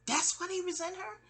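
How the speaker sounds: high disbelieving question; stunned, asking it high; incredulous. Retold or imitated. To stepdad on the stoop, high incredulous question. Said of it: "I'm on a stoop like".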